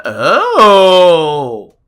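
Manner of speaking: ordinary talk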